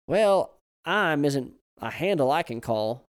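put-on loud, slow drawling old man. A narrator's reading. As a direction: tease gently like a grandpa.

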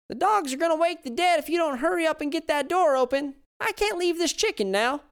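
put-on high, loud old Southern woman calling out. Old woman yells at husband from the kitchen, high and loud.

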